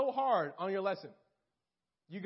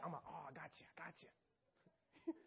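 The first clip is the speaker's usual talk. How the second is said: sneaky Satan whisper; 6 semitones lower, 16 dB quieter than usual. Retold or imitated. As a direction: creep up and whisper it like a lurking devil. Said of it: "I always imagine Satan that way".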